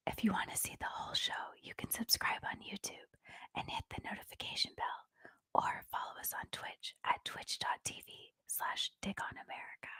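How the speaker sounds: very soft, fully whispered sign-off; ASMR whisper. Shown as a demonstration. Guest records show's ASMR outro, very whispery. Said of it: "that one might be too whispery".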